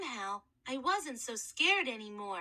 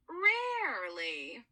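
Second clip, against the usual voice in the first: Mrs Coleman Levin's voice; 2 semitones lower, 3 dB louder, much slower than usual. Acted.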